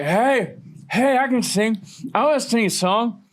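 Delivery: high-pitched drunk girl impression. Drunk girl asking the band to let her sing, high mock-drunk voice. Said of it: "extremely drunk".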